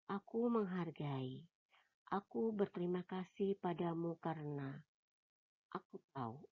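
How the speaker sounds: soft, intimate reading of a love letter template; hushed letter reading. Acted. Narrator reading a love letter template to a partner, hushed and intimate. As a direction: read the letter as if confiding to a loved one.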